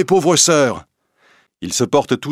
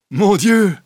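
high, loud cry of astonishment; astonished exclamation; 11 semitones higher, 4 dB louder than usual. A narrator's reading. Little John suddenly recognizes Will, exclaiming high and loud. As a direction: gasp it out in shock. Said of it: "s'exclame Petit Jean".

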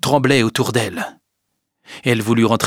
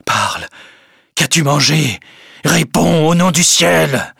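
louder, higher, frantic plea; desperate pleading; 6 semitones higher, 5 dB louder than usual. Acted. Charles on his knees begging Emma to answer, frantic and loud. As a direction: beg her for the truth. Charles begs dying wife.

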